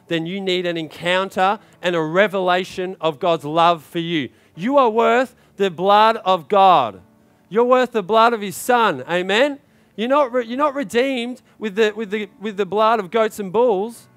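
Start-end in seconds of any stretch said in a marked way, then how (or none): 4.6-14.1 loud, raised sermon delivery; emphatic preaching. Real, not acted. Preacher telling believers their worth, emphatic full-voiced preaching. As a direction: proclaim it forcefully to the room.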